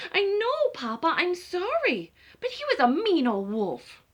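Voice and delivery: high, pleading little chicken; eager chicken. Acted. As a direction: excuse herself with big eyes. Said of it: "Chicken apologises".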